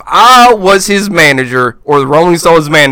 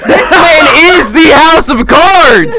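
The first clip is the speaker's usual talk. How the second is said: slow, higher, emphatic proclamation; mock outrage; 9 semitones higher, slower than usual. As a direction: stretch it out like a hype man. Mock outrage about actor.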